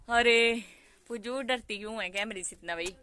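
ordinary talk

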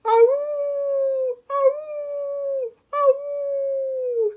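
high, long dog howl; drawn-out high howling; hound howl. Acted. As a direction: howl like a hound, holding the note. Hound dog character howls.

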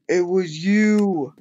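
shocked accusation. Real, not acted. Realizes who killed him.

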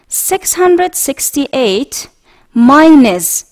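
slow, clear dictation pace; slow model reading. Shown as a demonstration. Teacher reading a subtraction problem aloud for students to repeat, slow. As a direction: spell out each number slowly.

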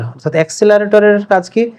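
ordinary talk, nothing marked

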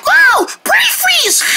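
brain freeze cry. Acted.

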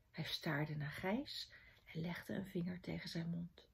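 very soft narration; quiet, secretive storytelling; hushed suspense. A narrator's reading.

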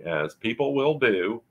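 ordinary delivery

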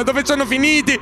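usual speaking voice

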